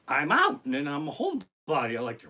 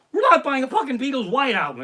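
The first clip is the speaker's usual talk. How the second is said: high, loud, emphatic refusal; exasperated outburst; 10 semitones higher, 9 dB louder than usual. Acted. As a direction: blow up at the very idea. Refusing his wife's wish, in outburst.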